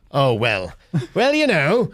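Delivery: put-on, hammed-up British voice; British accent. Shown as a demonstration. Director's turn for the host, British accent. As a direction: play a chatty Brit. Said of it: "Drew, what do you got?".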